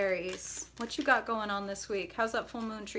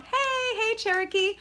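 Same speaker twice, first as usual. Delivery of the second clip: much higher, louder hello; excited greeting; 13 semitones higher, 5 dB louder than usual. Real, not acted. Reader greets a viewer mid-sentence, much higher excited hello. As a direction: call out a happy welcome. Greeting a live chatter by name.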